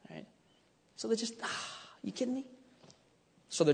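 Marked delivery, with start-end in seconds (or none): 1.4-2.4 mock incredulous. Mocking the rebels' disrespect.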